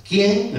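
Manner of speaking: "Quién" set apart, pitched high; pointed question word. Shown as a demonstration. Councillor pointing out his question's first word, high emphatic "quién". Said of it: "Empieza por quién. Es una pregunta".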